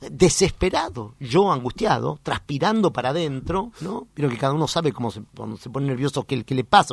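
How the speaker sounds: normal talking voice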